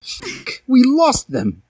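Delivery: high, sharp exclamation; exclaimed. A narrator's reading. Draco cursing after the two vanish, high exclamation. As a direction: blurt it out in alarm. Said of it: "exclaimed Draco".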